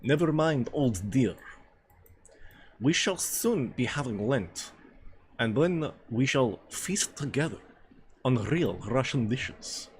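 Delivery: put-on hammy Russian accent, deliberate pace; stagy Russian accent with drawn-out phrases; Russian character. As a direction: play the hearty Russian dinner companion.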